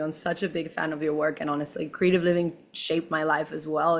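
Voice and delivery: ordinary talk, nothing marked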